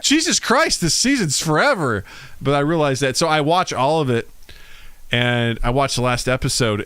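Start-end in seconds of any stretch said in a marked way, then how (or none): 0.0-2.1 retold exasperation. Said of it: "So I'm like".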